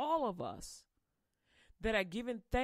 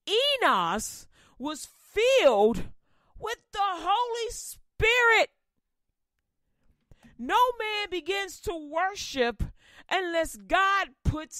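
loud, high preacher's cry, slow at the start; full-force preaching; 8 semitones higher, 11 dB louder, slower than usual. Acted. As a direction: preach it out to the whole church.

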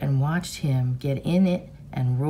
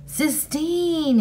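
high, drawn-out call of a name; shouted call; 11 semitones higher than usual. A narrator's reading. Boy calling after a girl as he runs, shouting. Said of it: "he shouted as he ran".

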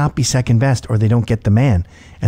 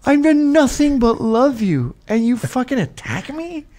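put-on high, slow, wounded drunk voice; mocking a wounded drunk woman's complaint; crushed drunk woman; 10 semitones higher, slower than usual. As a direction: whine like a hurt drunk. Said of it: "she's instantly crushed".

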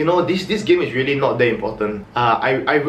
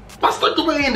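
hurried call; 11 semitones higher than usual. Retold or imitated. Hurrying dog inside.

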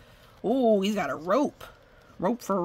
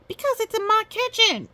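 high, louder exasperated outburst; snappish mayor; 5 dB louder than usual. A narrator's reading. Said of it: "the mayor snapped".

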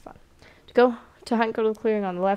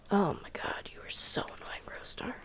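whispered, much softer, exasperated; whispered aside; 9 dB quieter than usual. Real, not acted. Player mutters at an annoying cat, whispered exasperation. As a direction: breathe it out as a secret aside.

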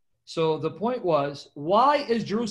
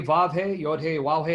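normal talking voice

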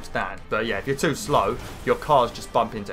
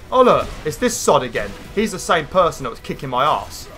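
mock annoyed gamer; 5 semitones higher, 5 dB louder than usual. Mocking other players' reaction.

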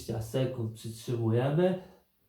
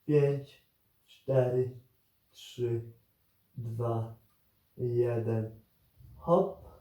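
counting down to go; 2 semitones lower, much slower than usual. Acted. Coach counts down a timed hold.

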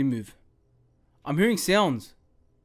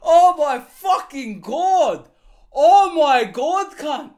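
loud, higher scream then drawn-out slow oaths; frightened scream then slow curses; 9 semitones higher, 11 dB louder, much slower than usual. Real, not acted. Gamer jump-scared, screaming and dragging out curses. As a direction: scream at the scare, then drag the swearing out slowly.

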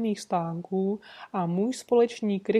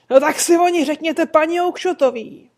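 much louder, higher angry retort; shouting; 7 semitones higher, 10 dB louder than usual. Acted. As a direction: bark it right back at him. Trader snaps back at man.

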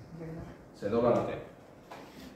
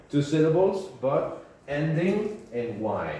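louder, higher, slowing on ending en Y; emphatic rule; 5 semitones higher, 4 dB louder, slower than usual. Shown as a demonstration. Teacher hammering the rule to the class, slow and emphatic. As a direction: drive the rule home slowly. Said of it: "teacher stresses rule".

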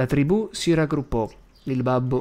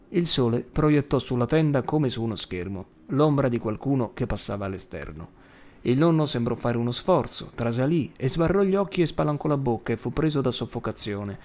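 ordinary delivery

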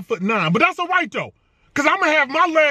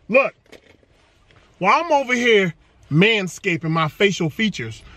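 ordinary talk

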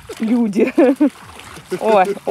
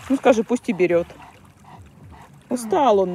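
ordinary talk

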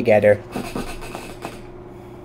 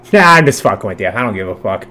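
ordinary delivery

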